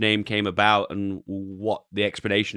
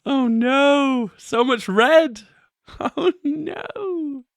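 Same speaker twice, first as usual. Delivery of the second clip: laughing groan; much slower than usual. Real, not acted. Streamer laughing at crashing crypto, high and giggly. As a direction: laugh helplessly at the red chart. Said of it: "I don't know why I'm laughing".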